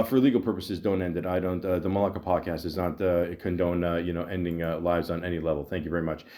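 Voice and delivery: low, subdued official notice; mock legal disclaimer. Podcaster disclaiming for legal purposes, low mock-official delivery. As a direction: drop your pitch and recite it as a formality.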